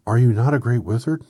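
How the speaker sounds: high, very loud question; astonished cry. A narrator's reading. Dorothy questioning the little man, loud and astonished. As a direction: call it out in loud disbelief. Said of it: "cried Dorothy".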